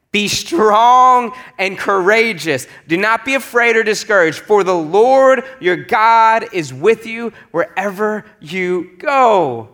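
loud, high, drawn-out command; ringing, slow charge from God; scripture proclamation. A narrator's reading. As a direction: proclaim it slowly, stretching the key words.

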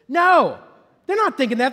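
loud, high burst of denial; emphatic no. Real, not acted. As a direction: shout the no down.

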